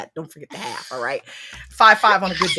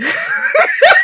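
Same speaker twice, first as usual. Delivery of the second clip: high laugh; 6 dB louder than usual. Real, not acted. Laughing at her own story.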